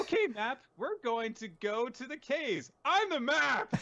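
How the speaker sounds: very high, loud, chirpy parody; cartoon show voice. Imitating Dora talking to the map, squealed parody. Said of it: "And it was like".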